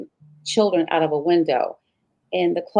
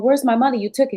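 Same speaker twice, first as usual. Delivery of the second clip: raised, higher yell at husband; retold yelling; 7 semitones higher, 4 dB louder, faster than usual. Wife confronting husband over stolen money, raised yell. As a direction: demand the money back, loud and high. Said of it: "I'm yelling and screaming".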